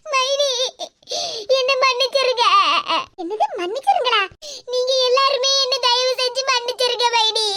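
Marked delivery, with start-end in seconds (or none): 0.1-2.4 tearful plea for forgiveness; weeping apology; crying. Acted. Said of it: "முதல்ல நீ அழுகையை நிப்பாட்டு". 4.7-7.5 sobbing, imploring apology; wailing off at the end; crying. Acted. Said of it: "முதல்ல நீ அழுகையை நிப்பாட்டு".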